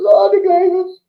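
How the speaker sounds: high, whiny mock moan; mocking moaning fan. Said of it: "they bitch and moan about the Glazers".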